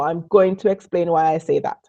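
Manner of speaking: ordinary delivery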